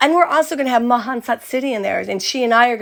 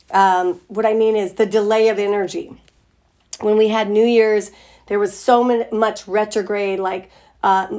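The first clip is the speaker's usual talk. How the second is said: ordinary delivery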